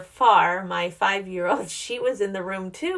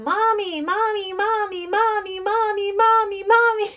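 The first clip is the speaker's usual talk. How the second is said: put-on high toddler chant, growing louder; toddler calling mommy; 13 semitones higher, 3 dB louder than usual. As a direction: keep nagging like a toddler, building up. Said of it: "keep calling him".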